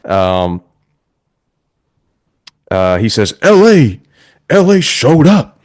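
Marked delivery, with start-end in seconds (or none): 3.4-5.5 high, loud mock promo accent; John Cena. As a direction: parody a wrestler's fake-accent shout-out. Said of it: "he has this fake accent going".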